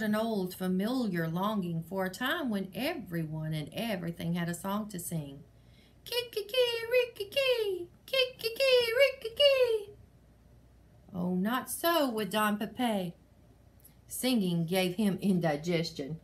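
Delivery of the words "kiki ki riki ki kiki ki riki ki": shrill rooster call; rooster crow. Acted. Rooster crows in story.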